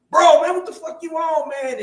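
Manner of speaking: high, loud disbelieving shout; older brother shocked after punch, loud; shocked yell. Retold or imitated.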